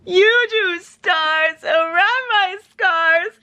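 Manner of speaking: high, slow, dreamy swoon; swooning quote. Acted. Quoting swoony book line.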